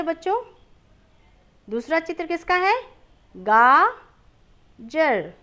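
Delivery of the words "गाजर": गाजर stretched out slowly and firmly; name गाजर slowly for children; slow naming. Shown as a demonstration. Teacher naming the picture for the class, drawn out. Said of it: "दूसरा चित्र है गाजर".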